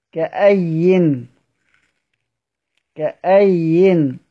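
كأين stretched very slowly, syllable by syllable; كأين recited slowly as a model; very slow recitation demo. Shown as a demonstration. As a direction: spell out كأين slowly for the class. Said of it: "وقف أبو عمرو على الياء".

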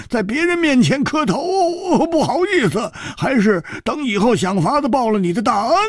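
put-on high, loud cackling old man; a jolly old elder full of gratitude; old beggar elder. A narrator's reading. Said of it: "那个苍老的声音". (苍老高亢的老者声音)

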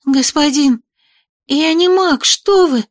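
put-on high, scared young girl voice; frightened girl. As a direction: blurt it out in fright. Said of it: "испуганно пробормотала девушка". (испуганно, девичьим голосом)

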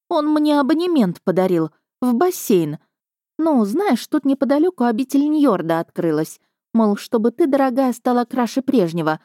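indignant griping; complaining. A narrator's reading. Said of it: "жаловалась Вера".

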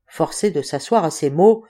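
usual speaking voice

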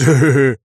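loud burst of laughter. A narrator's reading. Вася keeps laughing.

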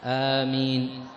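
آمين with the alif stretched slowly, light m; long drawn amen. Shown as a demonstration. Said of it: "أن يمد صوته". (آمين بمدّ الصوت ببطء)

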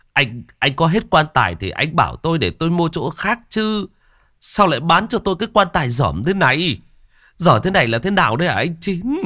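indignant complaint climbing to a high pitch; angry. A narrator's reading. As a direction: confront the coffin seller, voice rising with each question. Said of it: "ông Cảnh nổi giận".

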